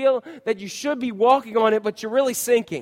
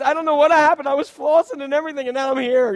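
higher, louder mock kid voice; whiny little kid; 8 semitones higher, 6 dB louder than usual. Pastor mimics a kid at the dentist, higher and louder. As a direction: ham up a bewildered child. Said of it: "when we go".

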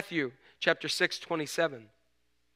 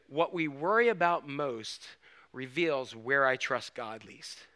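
slow emphatic repeat; 2 semitones lower, slower than usual. Shown as a demonstration. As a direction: let it sink in slowly. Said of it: "I'm gonna say that again 'cause you need to hear that".

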